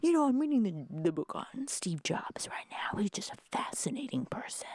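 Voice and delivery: high, breathy whispered dad; dad musing about Steve Jobs, whispered; dad impression. Daughter imitating dad on Steve Jobs, in a whisper. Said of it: "my dad was like".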